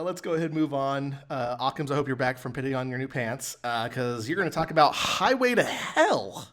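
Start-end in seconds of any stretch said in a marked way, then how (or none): 4.3-6.5 hyped-up intro, a bit louder; announcer lead-in. Shown as a demonstration. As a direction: announce the topic like a host. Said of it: "I don't know why I had to say it like that".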